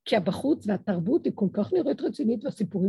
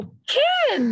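exclaimed yes; 6 dB louder than usual. Retold or imitated. Voicing God's reply.

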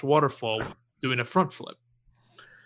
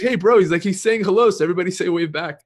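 louder and higher, a cheery oblivious bro; clueless friend greeting; 6 semitones higher, 8 dB louder than usual. Retold or imitated. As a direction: call out happily as if to a buddy waving. Friend cheerfully greeting, others laughing.

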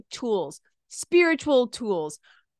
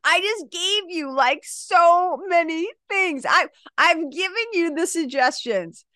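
mock exasperation, higher, dragging out so many; exasperated coach; 6 semitones higher than usual. Coach answers a client asking for more, mildly exasperated. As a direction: make the exasperation audible. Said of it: "And I'll be like".

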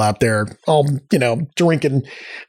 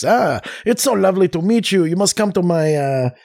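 put-on high, over-the-top French accent; hammy, high-pitched French accent; 6 semitones higher than usual. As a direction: gush as pretend French royalty, in a French accent. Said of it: "We keep talking to them in this".

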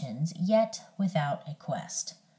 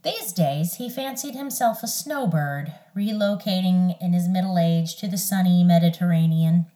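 plain reading voice

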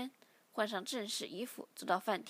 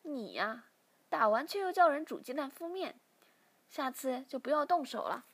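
higher, lightly voiced mother; a hint of a mother gently chiding; smiling mother; 3 semitones higher, 5 dB louder than usual. A narrator's reading. Mother chides father for hitting their son, lightly voiced, higher. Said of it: "母亲笑了笑".

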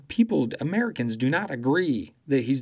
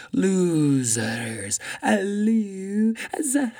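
mock loser taunt; 7 semitones higher, 3 dB louder, much slower than usual. Mocking Trump-style taunt.

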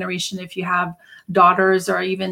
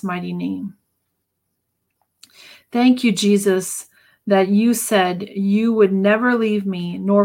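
deliberate declarative prayer with long pauses; slow prayer reading. Real, not acted. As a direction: pray it phrase by phrase, leaving room to repeat. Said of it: "[name] read very slowly".

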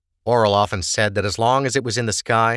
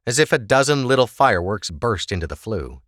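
plain reading voice

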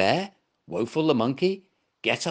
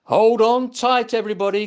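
shouted, much higher and louder; loud cry; 9 semitones higher, 7 dB louder than usual. Acted. Bear shouting to friends before the crash, loud. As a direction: bellow the order to grab on. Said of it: "cried Gumper".

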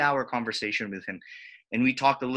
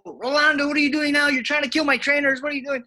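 high, loud alarmed protest from the gym; startled onlookers shouting at Rolando; alarmed gym crowd; 12 semitones higher, 4 dB louder, faster than usual. Retold or imitated. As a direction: voice the whole gym yelling in alarm. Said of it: "The whole gym would go".

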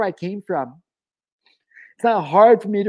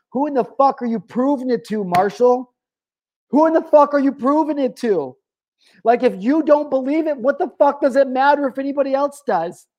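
much higher, urgent questioning of himself; intense self-challenge; 7 semitones higher than usual. Real, not acted. Speaker challenging his old self, high and urgent. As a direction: demand it of yourself, pitch climbing.

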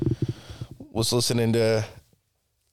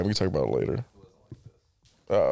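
usual speaking voice

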